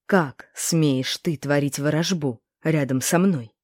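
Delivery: ordinary delivery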